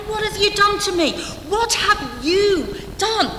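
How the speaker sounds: high, mock-furious Pharaoh; livid Pharaoh. Pharaoh scolding Abraham, hammed-up anger. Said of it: "He says to Abraham".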